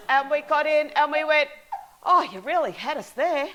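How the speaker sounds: loud laughter through the words; laughing hard while telling it; giggling loudly at the memory of the prank. Real, not acted.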